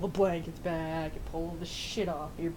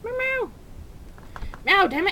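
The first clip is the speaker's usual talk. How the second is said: very high meows turning loud; loud high exasperated meows; frustrated meowing; 13 semitones higher, 7 dB louder than usual. Real, not acted. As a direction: demand a meow from the cat. Demands cat meow.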